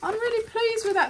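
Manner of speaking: high-pitched gleeful delight; delighted squeal. Real, not acted. Delighted with the gratitude card.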